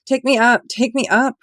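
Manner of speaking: high, loud terrified plea; begging. A narrator's reading. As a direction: beg to be pulled up. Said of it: "Roger begged".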